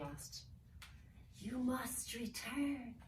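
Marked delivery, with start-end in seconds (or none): 1.4-2.9 slow, breathless whisper; eerie whisper. Acted. Tormented elf whispering to the children to return. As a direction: hiss it like a secret.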